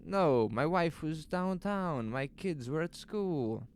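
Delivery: a worried man pleading his innocence; nervous shopkeeper Lois. Acted.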